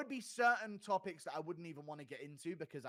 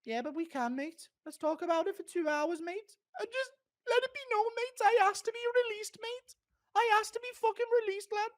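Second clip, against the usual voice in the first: English lad voice climbing ever higher and louder on each mate; Darren Till; 4 dB louder than usual. Retold or imitated. Imitated fighter insists on talking two hours, escalating high pitched lad voice. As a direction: wind the lad voice up into a squeaky rant. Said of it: "Impression of Darren Till".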